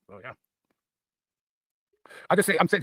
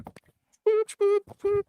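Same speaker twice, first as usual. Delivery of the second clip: mock camp boops.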